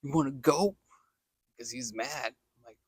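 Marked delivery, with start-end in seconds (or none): 0.0-0.8 loud, gruff-ish dare; mock tough challenge. Angry passerby confronting the laughing sweeper, loud dare. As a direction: square up and challenge him. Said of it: "He's like".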